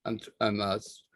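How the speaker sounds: stuttering restarts, trailing off quietly. Real, not acted. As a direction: stumble and restart the words. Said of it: "stuttering up here".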